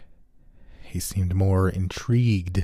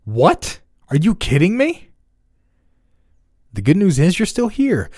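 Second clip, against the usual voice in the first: high, loud incredulous outburst; shocked disbelief; 11 semitones higher, 6 dB louder than usual. A narrator's reading. Jack reacts to the doctor, shocked and loud. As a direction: blurt it out in disbelief.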